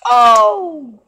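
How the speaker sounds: a sudden loud Oh, then darn it; startled loud cry. Real, not acted. Vlogger startled by a painful fall in a clip. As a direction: jump at the screen.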